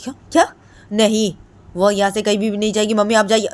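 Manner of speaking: loud, high angry reply; angry shouting. Retold or imitated. Jiya yelling at her mother to leave, angry. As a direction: snap back angrily at her mother. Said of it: "तभी जिया बोलती".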